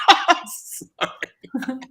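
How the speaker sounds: breaking into high giggles mid-word; can't hold it together, laughing; laughing fit. Real, not acted. Giggling at dingle comment.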